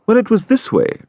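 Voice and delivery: lightly voiced Enfield. A narrator's reading. Enfield begins his tale.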